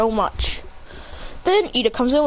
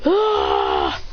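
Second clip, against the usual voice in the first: low groan. Acted. Villain hit.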